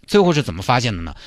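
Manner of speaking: normal talking voice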